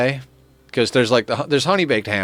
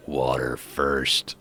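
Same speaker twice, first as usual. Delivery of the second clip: even lower, very slow deadpan; deadpan repeat; 10 semitones lower, 7 dB quieter, much slower than usual. Retold or imitated. Retelling the friend's reply to the waitress, slow low deadpan. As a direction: savor the deadpan punchline. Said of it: "looks at her deadpan and goes".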